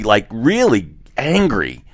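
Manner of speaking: ordinary talk, nothing marked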